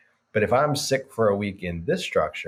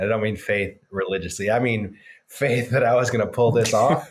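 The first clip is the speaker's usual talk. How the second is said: normal talking voice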